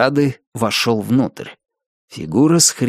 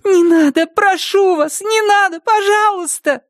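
high, desperate begging; thin pleading voice. A narrator's reading. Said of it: "Тонкий голос отчаянно умолял".

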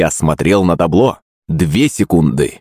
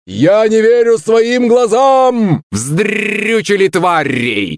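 high, drawn-out jubilant squawk; joyful parrot yell; 13 semitones higher, much slower than usual. A narrator's reading. Parrot celebrating the goal, joyful high slow screech. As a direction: stretch it out, crowing like a parrot. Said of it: "радостно закричал Рэмбо".

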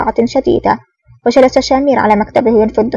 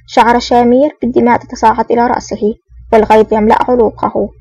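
ordinary delivery, nothing marked

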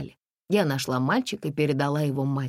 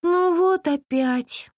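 higher, slow weary sigh; 4 dB louder, much slower than usual. Acted. Girl sighing at yet another chase. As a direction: groan it out tiredly. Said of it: "вздохнула я".

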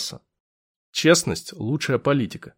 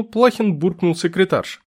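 plain reading voice

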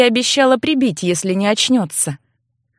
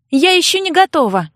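calling out; 8 semitones higher, 3 dB louder than usual. Acted. Calling through the door.

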